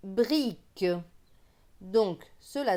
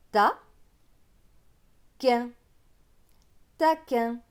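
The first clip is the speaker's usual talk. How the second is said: syllable by syllable; 4 dB louder than usual. Shown as a demonstration. Teacher leading a reading drill, syllables then whole word. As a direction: split "Ta. Quin." then join as "Taquin". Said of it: "la ligne suivante".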